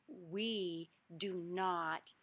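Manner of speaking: very slow, firm, word-by-word correction; very stern. Retold or imitated. Parent kneeling to the child who hit, very slow and stern. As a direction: lay down the rule slowly and firmly. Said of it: "very stern".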